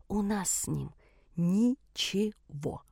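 «ничего» drawn out very slowly, syllable by syllable. A narrator's reading. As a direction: spell out «У нас с ним ничего» one syllable at a time. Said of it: "по слогам произнесла я". (по слогам, «ни-че-го» очень медленно)

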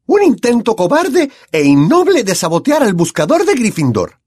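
high, loud, indignant scolding; a strict professor tearing into cheats; angry stern teacher. A narrator's reading. McGonagall scolds the Slytherin players, angry and loud. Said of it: "muy enfadada".

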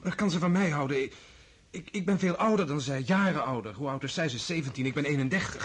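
loud, high and agitated; anxious outburst over the age gap; agitated protest. Acted. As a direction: blurt out his worries in a rush.

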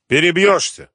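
high, loud angry refusal; angry retort. A narrator's reading. Gangster refusing his captive a shirt, angry. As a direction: snap it back, high and loud. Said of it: "разозлился Митя".

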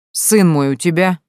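put-on a touch of drunken rudeness; rude. A narrator's reading. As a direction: curtly demand the boy back. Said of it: "грубо ответил пришедший".

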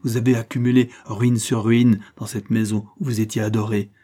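loud, relentless charges; violent accusation. Acted. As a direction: pile the accusations on her. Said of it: "fit-il avec violence".

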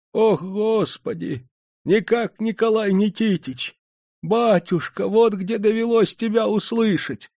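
put-on old beggar's voice, high and slow with joy; he recognises his master, moved to joy; overjoyed old man. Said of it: "Обрадовался старик". (старик радостно, высоко и протяжно)

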